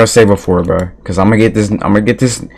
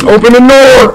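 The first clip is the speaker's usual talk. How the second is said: high, loud panicked yelling; 5 dB louder than usual. Real, not acted. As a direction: scream for the door to open. Dying in the game.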